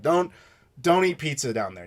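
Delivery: normal talking voice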